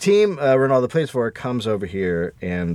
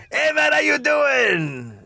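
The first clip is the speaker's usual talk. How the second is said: put-on East LA accent; 10 semitones higher than usual. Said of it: "That's East L A".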